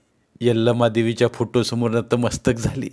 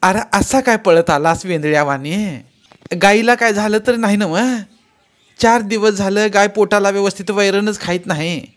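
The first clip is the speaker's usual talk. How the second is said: put-on higher, louder scolding old mother; mother snapping; 9 semitones higher, 5 dB louder than usual. Mother scolds Shankar for running in, loud high snap. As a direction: snap at your son for the spilled water. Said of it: "आई त्याच्यावर खेकसलीच".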